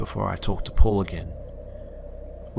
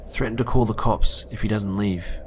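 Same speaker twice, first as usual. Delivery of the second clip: usual speaking voice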